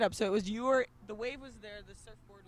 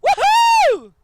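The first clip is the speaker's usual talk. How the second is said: very loud, high, drawn-out whoop; whooping cheer; 18 dB louder than usual. Real, not acted. Host answering about the World Cup, huge cheering whoop. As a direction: let out a huge "woohoo".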